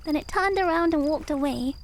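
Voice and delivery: plain reading voice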